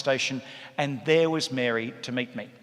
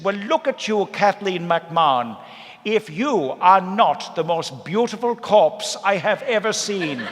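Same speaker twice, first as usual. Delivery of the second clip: higher, louder, slow Irish aunt gushing; Mary's voice; 5 semitones higher, 5 dB louder, slower than usual. Retold or imitated. Mary praising dead Kathleen in the coffin, loud, high and slow. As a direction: lay on the admiration like an old Irish matriarch.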